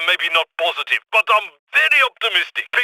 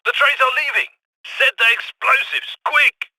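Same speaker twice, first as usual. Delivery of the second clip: higher-pitched, urgent call to act; urgent warning; 6 semitones higher than usual. Acted. Warning the crew that the trains are leaving, urgent. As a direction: rush them into action, pitch climbing to the last word.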